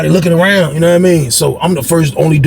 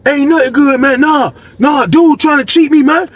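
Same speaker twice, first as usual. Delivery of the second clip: pitched-up upset rant; upset Lee; 9 semitones higher than usual. Retold or imitated. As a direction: complain like Lee being cheated. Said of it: "Lee like".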